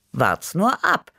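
smug, drawn-out promise; knowing. A narrator's reading. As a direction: stretch it out, sure you know better. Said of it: "wusste ich es besser".

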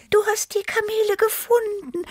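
very high, hopeful cheer; high-pitched hopeful delight; jubilant Tibi. A narrator's reading. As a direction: cheer it in a squeaky little voice. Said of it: "jubelte Tibi hoffnungsvoll".

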